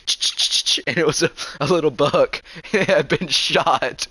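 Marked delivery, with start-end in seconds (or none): 0.0-0.8 breathy imitated rustle of leaves; unvoiced ch-ch rustling sound; crunching footsteps. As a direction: mimic something moving through the brush. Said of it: "all of a sudden he hears".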